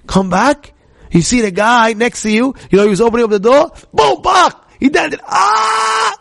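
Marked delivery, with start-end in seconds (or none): 3.9-4.7 high, loud crash noise; imitated crash. Imitates door smashing new car. 5.3-6.2 long, high-pitched "ah!"; horrified mock scream. Car owner screams at damage.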